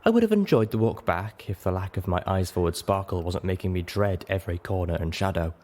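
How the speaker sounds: ordinary delivery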